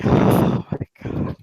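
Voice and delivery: slow, breath-only sigh; exasperated sigh. Acted. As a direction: sigh the words out wearily. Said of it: "so Erica just sighs".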